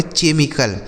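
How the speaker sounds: "केमिकल" misread with ch, che-mi-kal; common mispronunciation. Shown as a demonstration. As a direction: say "केमिकल" starting as in chair. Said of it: "इसे केमिकल नहीं पढ़ा जाता है".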